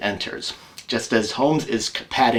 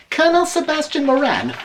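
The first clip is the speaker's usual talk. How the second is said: high, formal maid's announcement; a high maid ushering in a colonel; housekeeper announcing; 4 dB louder than usual. Acted. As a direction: present the guest in a high servant's voice.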